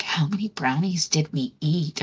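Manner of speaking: hushed, breathless whisper; a retold secret whisper. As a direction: whisper it so no one nearby hears. Stoned friend on the plane.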